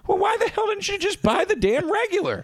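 retold high-pitched exasperation; exasperated outburst. Host retelling his comeback to his friend, exasperated. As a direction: plead in high, comic indignation.

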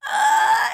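sudden very high strained grunt; a loud straining yelp at the bowling lane; bowling body-english grunt. Retold or imitated. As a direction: yelp as if willing the ball to curve. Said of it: "and you go".

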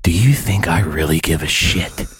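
seething whispered rant, slowing at the end; hushed furious inner voice; angry whisper. Retold or imitated. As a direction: whisper the insult you'd never say aloud. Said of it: "here's my response".

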